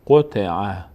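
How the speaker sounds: قطع as one joined word, "qo-te-'a" without pauses; read joined together. Shown as a demonstration. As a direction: run the three short syllables of قطع together. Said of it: "اگه سر هم بخونیم". («قطع» سر هم، قُتِعَ)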